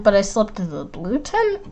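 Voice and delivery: ordinary delivery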